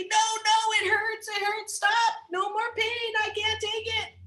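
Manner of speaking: high, whining protest; whiny rock. Acted. Life mimics the rock whining about the chisel, high. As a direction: whimper like it hurts. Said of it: "you say".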